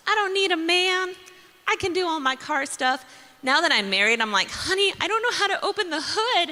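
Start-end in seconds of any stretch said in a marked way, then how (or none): 0.1-3.0 high, sassy independent boast; lightly voiced sassy younger self; mock bravado. 4.5-6.5 high, giggling helpless plea; high laughing plea to husband; helpless wife. Retold or imitated.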